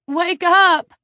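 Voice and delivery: high, loud urgent cry; desperate cry. A narrator's reading. Claudia shaking her cellmate awake, crying out desperately. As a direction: cry out to rouse someone. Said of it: "Claudia cried".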